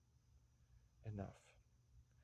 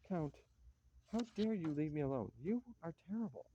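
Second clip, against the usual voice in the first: tearful reproach; 3 semitones higher than usual. Acted. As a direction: scold him through tears.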